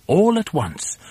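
usual speaking voice

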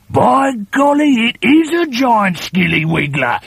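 put-on high, loud exclaiming old man; mock amazed husband. A narrator's reading. Husband pretends alarm at the frog, loud mock exclaim. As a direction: gasp it in fake alarm. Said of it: "Mister Twit said".

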